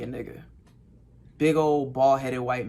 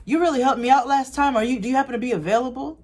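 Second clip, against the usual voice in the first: higher, bright pleading ask, rushing at the end; eager customer; 9 semitones higher, 4 dB louder than usual. Retold or imitated. Customer asks George if he's free, eager and rushing. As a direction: beg for the favourite masseur, speeding up.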